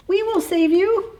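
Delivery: high little bee voice; tiny heroes to the rescue; eager bees. A narrator's reading. Bees try to hold her.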